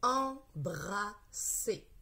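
"Embrasser" very slow, in three separate syllables; word sounded out. Shown as a demonstration. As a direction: pause between each syllable of "Embrasser". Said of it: "Maintenant, nous allons lire les mots". ("Embrasser" découpé très lentement, em-bra-sser)

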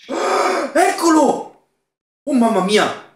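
high, loud shriek of fright; startled cry. Real, not acted. Gamer startled by the monster, high fearful cry. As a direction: jump out of your skin. Jump scare in horror game.

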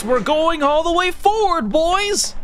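rallying hype. Real, not acted. Rallying the chat.

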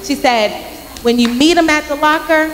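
usual speaking voice

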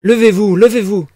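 amused and alarmed cry. A narrator's reading. Dounia to the kneeling student, amused and worried cry. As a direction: plead with him to get up, amused yet worried. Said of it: "criait Dounia, amusée et inquiète".

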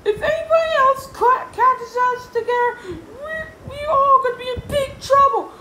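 very high, loud, urgent; Yesterday George warning. Acted. Yesterday George warning they'll be caught together, high and loud. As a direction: warn them at top pitch.

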